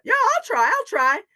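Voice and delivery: very high, loud, excited little voice; eager volunteer. Acted. Muskrat answers the doubting animals, eagerly. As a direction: jump at the chance.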